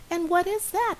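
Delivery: high, eager mouse question; little mouse asking. Acted. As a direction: ask eagerly, pitched high. Mouse asks the bee.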